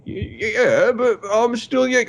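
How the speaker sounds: high, stammering mock protest; protesting organ donor. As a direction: object in a high, flustered voice. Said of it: "two organ donor guys show up to collect".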